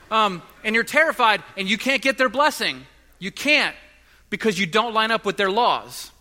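loud, high, driving sermon voice; impassioned preaching. Acted. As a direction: build the urgency to the congregation. Preaching at full force.

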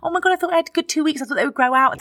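high, fast flustered excuse; panicked excuse. Retold or imitated. Actress excusing her nails to angry makeup artists, high and fast. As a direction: blurt it out in a panic. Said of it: "I was like".

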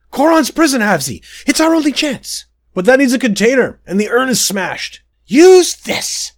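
high, loud urgent yelling; 必死に叫ぶ; frantic shout. Acted. Frantic fight with demon.

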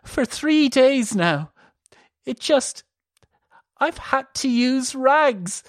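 high, slow halting fear; distressed. A narrator's reading. Elisa confessing to her sister, distressed. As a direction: drag it out, ashamed and scared.

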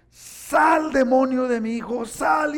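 high, loud commanding cry; furious father yelling at the devil; shouting father. Retold or imitated. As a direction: order the demon out at full voice. Father exorcising son with belt.

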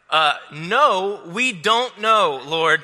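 hammed-up doubter; playfully doubtful disciple; doubting Thomas. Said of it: "old Tommy Boy doubting fashion".

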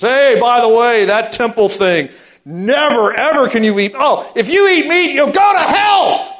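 high, loud, slow ranting shout; hammed-up shouting zealot. Zealot in church shouting at the congregation, a hammed-up mock rant. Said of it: "the guy that comes into the church and goes".

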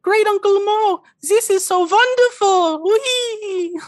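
put-on very high, loud squealing child; excited little boy. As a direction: cheer for your great uncle in a piercing kiddie voice. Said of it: "the little boy goes".

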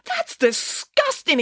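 very high, slow outburst of revulsion; disgusted exclamation. A narrator's reading. Teacher recoils at the poop evidence, exclaiming. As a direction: draw it out in high-pitched disgust. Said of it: "exclaimed Mister Spencer".

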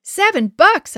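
high, loud burst of disbelief; shocked gasp. A narrator's reading. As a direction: gasp it out, aghast at the price. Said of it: "I gasped".

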